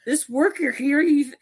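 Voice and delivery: high-pitched pretend panic; mock distressed niece. Niece questions and old man blames the worker, high mock alarm. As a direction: imitate her alarm, voice way up. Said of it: "his niece".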